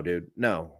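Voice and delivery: usual speaking voice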